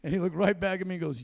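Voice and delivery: ordinary talk, nothing marked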